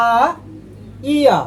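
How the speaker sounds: আ then ইয়া, each said on its own; Korean vowels a, ya. Shown as a demonstration. Teacher contrasting two Korean vowels for learners. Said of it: "তাহলে".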